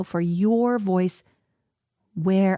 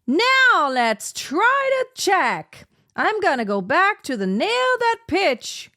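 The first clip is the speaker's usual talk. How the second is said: level three intensity; 9 semitones higher, 3 dB louder than usual. Shown as a demonstration. Coach demonstrating level three to students, intense high voice. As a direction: push the pitch way up, stretching the stressed words. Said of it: "Now let's do level three".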